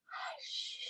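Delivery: breathy unvoiced hush out the mouth; whispered hush. Shown as a demonstration. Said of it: "One more time".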